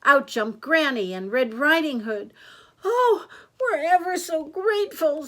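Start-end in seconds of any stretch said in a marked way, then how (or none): 2.9-5.3 put-on high, loud girlish relief; raised, effusive storybook child. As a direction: gush thanks as a little girl.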